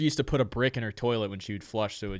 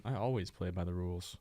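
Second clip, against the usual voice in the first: low, quiet, deadpan insistence; 5 semitones lower, 11 dB quieter than usual. Pretend. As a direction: insist on your innocence, quietly and flat. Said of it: "I don't know, man".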